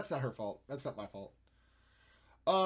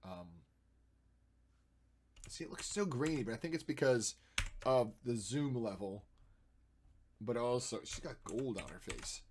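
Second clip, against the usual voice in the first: normal talking voice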